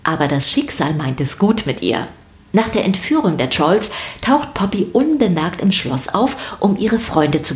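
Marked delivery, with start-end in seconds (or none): none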